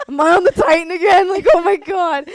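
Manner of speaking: loud, high cry of dread; playful high-pitched alarm; mock panic. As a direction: laugh through a hammed-up freak-out. Acts panic at bumps in car.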